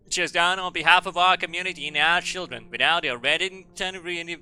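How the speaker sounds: orating headmaster. Acted. Headmaster addressing the community and children, orating. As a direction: declaim it like a pompous school speech.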